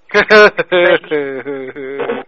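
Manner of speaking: long chuckle at the caller; amused laughter. Real, not acted. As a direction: laugh at the British guy talking. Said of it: "What's so funny?".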